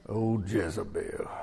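low, slow, ominous Oh, Jezebel; dramatic drawn-out Jezebel. Shown as a demonstration. Preacher showing the congregation how to say her name, ominous and low. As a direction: draw out Oh, Jezebel low and soft like a dire warning. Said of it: "You got to say it like that".

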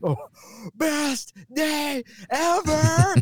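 gasp, then stretch out the joy; excited kid. Retold or imitated. The thrilled kid answering his dad, gasping with glee. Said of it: "he's so excited".

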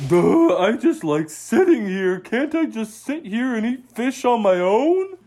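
put-on a lazy kid otter begging to stay put; lazy young otter. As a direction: Otto whining to his dad to just sit and eat. Lazy Otto whines to dad.

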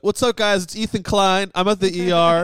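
loud, high-pitched vlog greeting; livestreamer impression. Patient going live from the ER, loud mock streamer voice. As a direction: imitate a streamer hyping up the viewers. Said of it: "he goes live and he's like".

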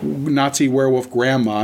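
ordinary delivery

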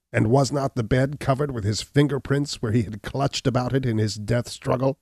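Gall, indignant and rising; defiant cry. Acted. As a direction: throw the accusation back in his face. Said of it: "cried Gall".

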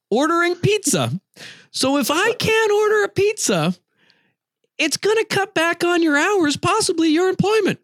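high-pitched, insistent retold scolding; exasperated lecture. Indignantly lecturing the clerk in retelling.

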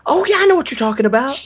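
high-pitched, eager bluffing; imitated over-keen agreement; mock recognition. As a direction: gush as if faking familiarity. Mimics people pretending to know.

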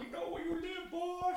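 high-pitched strained heckler; hammed-up threatening local; mock threat. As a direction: call it out as a silly menace.

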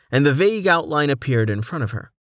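ordinary delivery

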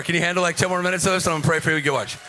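ordinary talk

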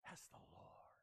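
hushed gasp of recognition; awed excited whisper. Retold or imitated. Excited disciple spotting the Lord on shore, whispering. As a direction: whisper it in amazement. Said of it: "Peter got so excited".

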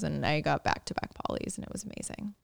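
normal talking voice

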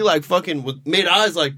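ordinary talk, nothing marked

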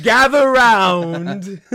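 slow, loud, drawn-out herald call; town crier. Pretend. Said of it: "announce that you're doing an announcement".

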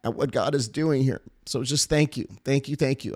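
quiet thanks, fighting to keep composure; emotional and hushed, near tears; choked up. Real, not acted. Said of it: "I don't wanna get emotional right now".

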